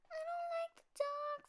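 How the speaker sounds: high small cub voice, scared; a tiny frightened cub; little bear cub. A narrator's reading.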